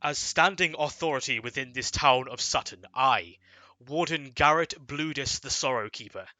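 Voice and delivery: loud, formal proclamation of banishment; stern warden decree. Acted. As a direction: pronounce the sentence like a town warden.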